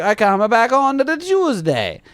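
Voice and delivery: slow, high mock Italian accent. Plumber offering tenant another day, slow mock Italian accent. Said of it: "He's Italian".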